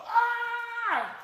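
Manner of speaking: high, loud cathartic "Arr!"; a full loud cry to release feeling; demo release yell. Shown as a demonstration. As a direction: let it out as a loud, high roar. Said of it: "Just go".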